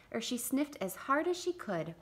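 ordinary delivery

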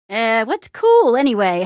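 usual speaking voice

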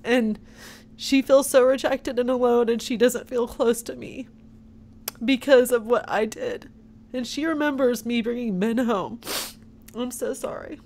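holding back tears while speaking; teary-eyed. Real, not acted. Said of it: "I'm sorry I get teary-eyed".

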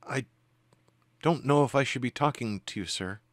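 timid woman's voice, unsure whether to speak; timid female spirit. Acted. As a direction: answer haltingly as a timid, deferential woman. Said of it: "in a timid female voice".

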